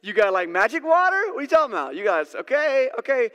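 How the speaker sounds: loud, high, half-laughing "magic water?"; amused scoffing outburst; incredulous laughing disbelief. Retold or imitated. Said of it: "started laughing out loud".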